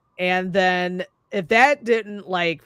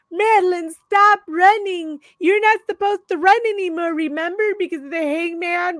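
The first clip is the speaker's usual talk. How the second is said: much higher, pitched-up frantic call; panicked girlfriend; 9 semitones higher than usual. Retold or imitated. Haley calling after Madeline to stop running, high frantic mock voice. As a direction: shriek after her in a high, hurried voice.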